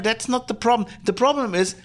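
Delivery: usual speaking voice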